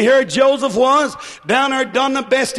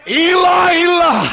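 anguished loud cry; 5 semitones higher, 3 dB louder than usual. Acted. Cry from the cross.

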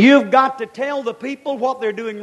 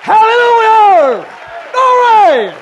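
high, loud hallelujah shout; shouted praise; 10 semitones higher, 11 dB louder, much slower than usual. Real, not acted. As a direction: cry out glory at full force. Preacher erupts in praise.

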